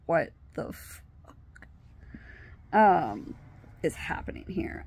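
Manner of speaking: slow, stunned whisper. Retold or imitated. Reacting to a man grabbing her friend, stunned whisper. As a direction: whisper it slowly in disbelief.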